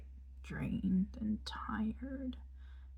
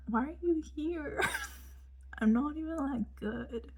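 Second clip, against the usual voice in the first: high-pitched retold protest; mock disbelief; 6 semitones higher than usual. Cellist to Anne at the concerts, high mock protest. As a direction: play up the bafflement, voice raised high.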